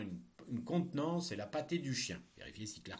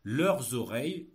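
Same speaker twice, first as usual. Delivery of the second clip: dictating; 8 dB louder than usual. Shown as a demonstration. Teacher dictating a text.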